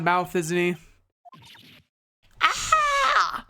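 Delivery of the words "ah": high sharp yelp of fright; short high startled cry. Acted. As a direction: jump and yelp. Cries out as boss arrives.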